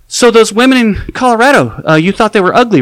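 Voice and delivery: loud, high, pointed question; leading question. Retold or imitated. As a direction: push him to say they were ugly. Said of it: "and I'm like".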